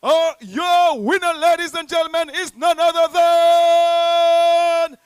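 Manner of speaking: big winner reveal. Real, not acted. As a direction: drag out the winner's name like a ring announcer. MC builds to announcing winner.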